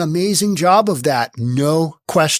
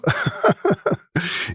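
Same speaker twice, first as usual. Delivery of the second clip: laughing at himself; 5 dB quieter than usual. Real, not acted. Laughing at his bad Spanish.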